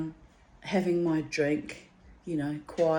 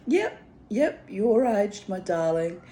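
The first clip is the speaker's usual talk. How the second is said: teasing; 3 semitones higher, 4 dB louder than usual. Retold or imitated. Teasing reply to young man.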